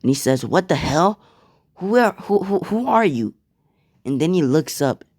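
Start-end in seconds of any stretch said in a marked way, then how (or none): 0.4-3.4 high-pitched, stammering surprise; startled. Acted. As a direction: blurt it out, tripping over the question. Deku startled by bumping into someone.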